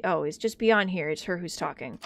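ordinary delivery

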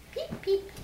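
high little chick peeps; peeping baby chick. Pretend. Answering what chick says.

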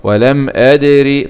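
أدر slow with a bouncing qalqala on the dal; tajweed demo. Shown as a demonstration. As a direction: make the دال of أدر bounce. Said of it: "الدال فيها حكم إيه". (أدر بقلقلة الدال)

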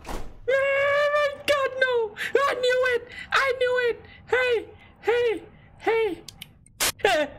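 high panicked wail, near tears; terrified high shriek. Real, not acted. Gamer caught by a jumpscare, high terrified crying shriek. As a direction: shriek it out as the monster comes.

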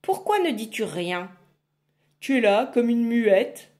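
loud gruff witch complaint; grumbling witch. Acted. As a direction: growl at the silent girl. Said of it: "la sorcière grommela".

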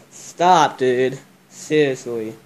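usual speaking voice

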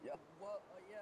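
normal talking voice

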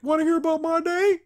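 very high, put-on cartoon voice; high mock-chirpy question; Hootie. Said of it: "Hootie's like".

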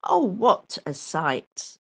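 usual speaking voice

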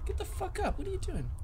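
a squeaky creature yelling at a friend; voiced character.

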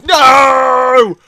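high, loud drawn-out no; anguished gamer yell; frustrated scream. Real, not acted. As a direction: cry out in frustration as it all goes wrong. Frustrated at game failure.

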